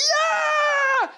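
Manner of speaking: high, fast excited yell; shouted cheer. Retold or imitated. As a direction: cheer with everything. (begeisterter Jubelruf)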